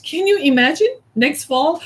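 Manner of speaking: ordinary talk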